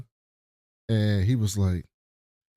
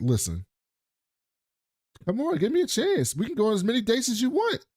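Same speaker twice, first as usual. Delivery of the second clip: high, rushed mock begging; pleading suitor; 10 semitones higher than usual. Man begging the woman for a chance, high hurried mock plea. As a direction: plead for a date in a hammed-up high voice.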